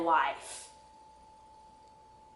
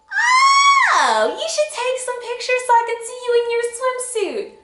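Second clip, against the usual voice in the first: much higher, loud gushing mom voice; excited mom; 5 dB louder than usual. Retold or imitated. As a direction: gush like an eager mother.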